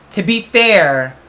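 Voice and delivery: normal talking voice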